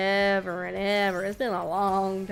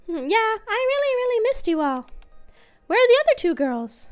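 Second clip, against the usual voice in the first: put-on high, childlike character voice; young girl voice; 11 semitones higher, 4 dB louder than usual. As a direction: voice a shy little girl.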